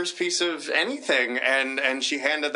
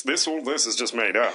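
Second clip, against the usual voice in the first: lower, ponderous Tatum voice; a light take on J. Michael Tatum; deep Tatum impression; 4 semitones lower than usual. [name] doing his Tatum impression for the panel. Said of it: "Look at you doing a deep voice".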